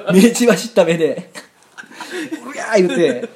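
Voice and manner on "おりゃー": high yell with a laugh in it; battle cry. Acted. Acting out his charge at the opponent, laughing battle cry. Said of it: "大きい声で".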